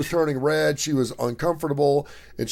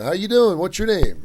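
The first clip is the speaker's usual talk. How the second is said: friendly worker; 7 semitones higher, 5 dB louder than usual. Retold or imitated. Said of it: "And he's like".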